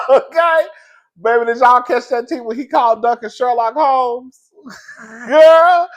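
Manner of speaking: loud, high, laughing through it; laughing hype. Real, not acted. Vlogger crowing to viewers about the burn, laughing loud. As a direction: crack up loudly and shout it out.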